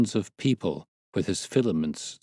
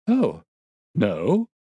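surprised denial; 2 semitones higher, 5 dB louder than usual. A narrator's reading. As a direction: protest in mild disbelief. Watson denies having expressed incredulity.

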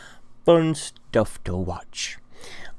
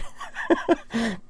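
a high laugh at himself; 12 semitones higher, 4 dB quieter than usual. Real, not acted. Laughing at how chipper he sounds to viewers. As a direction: let a giggle out at yourself.

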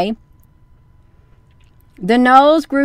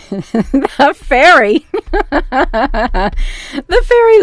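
giggling while reading; 3 semitones higher than usual. Real, not acted. Reader giggling through sentence.

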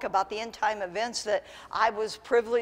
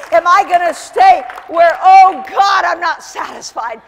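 louder and higher with each question; building preaching shout; 6 semitones higher, 12 dB louder than usual. Acted. Preacher urging the congregation to stay hungry, building to a shout. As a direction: climb to a full-force shout.

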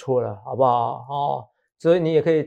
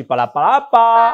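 mock babble; 6 semitones higher, 4 dB louder, slower than usual. Mimics being knocked around.

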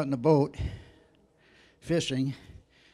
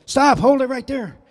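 urgent shout; 11 semitones higher, 9 dB louder than usual. Retold or imitated. Charlie warns in dark woods.

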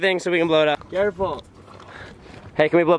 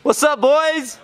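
hyped greeting; 7 semitones higher, much slower than usual. Real, not acted. Greeting the boys before a ride, high and excited.

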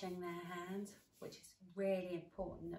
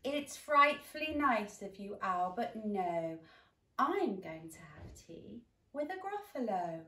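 posh polite mouse; 3 semitones higher, 9 dB louder than usual. Acted. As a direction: decline very politely. Mouse replies to owl.

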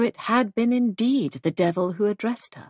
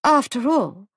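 higher, louder, a touch anxious; slight anxiety; 5 semitones higher, 5 dB louder than usual. A narrator's reading. Hostess justifying herself to a guest, slightly anxious. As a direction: let a little worry show. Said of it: "Molly's voice betrayed slight anxiety".

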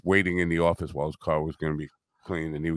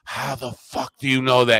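louder, higher stunned demand; retold astonishment; 5 semitones higher, 5 dB louder than usual. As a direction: act out a jaw-dropped stranger. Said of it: "his jaw was at the bottom".

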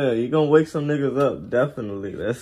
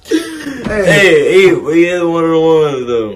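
laughing; 4 semitones higher, 9 dB louder, slower than usual. Real, not acted. Laughing hype among friends.

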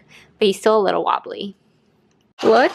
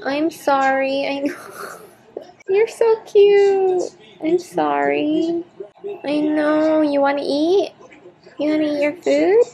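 much higher, slow, doting coo; 7 semitones higher than usual. Real, not acted. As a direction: melt over the dog, stretching the words. Cooing at the dog in cone.

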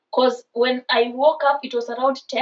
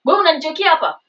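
angry; 6 semitones higher, 3 dB louder, faster than usual. Retold or imitated. Angry confrontation with boyfriend.

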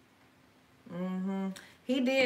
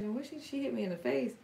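ordinary delivery

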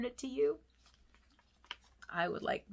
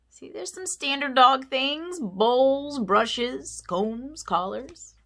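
higher and louder, slow listing; excited aside; 7 semitones higher, 7 dB louder, much slower than usual. Acted. Narrator tells kids about dog things, excited and louder. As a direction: sell each item with delight.

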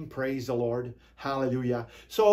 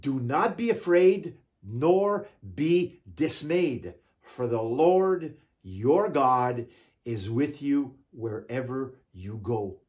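slow solemn reading; 4 semitones lower, slower than usual. Acted. Solemn scripture reading, drawn out.

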